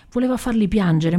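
ordinary delivery

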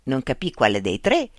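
usual speaking voice

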